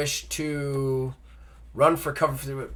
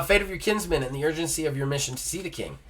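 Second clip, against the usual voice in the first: normal talking voice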